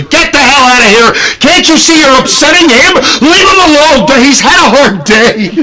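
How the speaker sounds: high-pitched mock-furious scolding; angry yelling. Upset parent figure scolding haunt guests over Gooey, hammed-up angry yell. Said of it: "now I'm yelling at them angrily".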